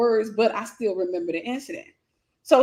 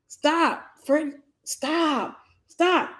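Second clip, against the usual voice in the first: higher, rising plea to stop; mild retold plea, higher; pleading stop; 4 semitones higher, much slower than usual. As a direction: beg him to stop, climbing higher each time. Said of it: "I'm saying".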